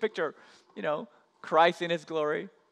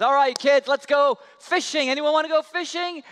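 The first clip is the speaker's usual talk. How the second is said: loud, higher hearty call; 9 semitones higher, 8 dB louder than usual. Acted. Grandpa calls the kids to go fishing, loud and hearty. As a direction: call the kids from across the yard.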